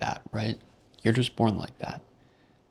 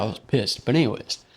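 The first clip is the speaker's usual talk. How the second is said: normal talking voice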